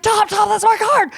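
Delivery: very high squealed re-enactment; acted-out starstruck squeal; retold excitement. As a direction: squeal it urgently to a friend. Retelling her thrilled reaction.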